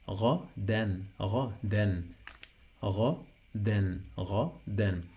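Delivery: "gha, then" as throaty gha, pause, dan; syllable-by-syllable. Shown as a demonstration. Teacher models added noon ending, syllable by syllable. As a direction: split "gha, then" in two, sounding the final n. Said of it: "So that's why we say gha".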